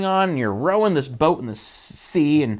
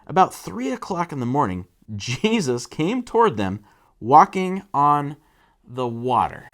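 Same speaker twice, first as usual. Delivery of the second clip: ordinary talk, nothing marked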